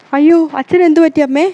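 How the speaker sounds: high, loud panicked question; alarmed cry. Acted. Daughter asks her wailing mother, high alarmed cry. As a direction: shout in sudden fright.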